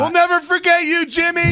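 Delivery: high-pitched hammed-up farewell; mock tribute. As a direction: call it out as a jokey send-off. Joking interjection about UK fans.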